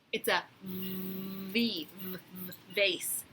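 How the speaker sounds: slow, soft v, v, vase; teacher sounding out a letter. Shown as a demonstration. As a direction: buzz the v sound. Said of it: "What does valley start with?".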